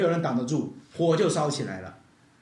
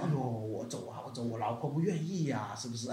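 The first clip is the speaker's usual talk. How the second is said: quiet helpless whine, much softer; soft whining excuse; 7 semitones lower, 10 dB quieter than usual. Acted. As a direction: sigh out the excuse as weak Lot. Lot pleading, wife unwilling. (罗德小声无奈地抱怨)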